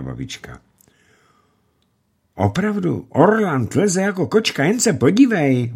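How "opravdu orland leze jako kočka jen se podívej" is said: put-on high, eager boy's voice; boy calling out. A narrator's reading. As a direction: insist like an excited boy. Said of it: "volal Jan".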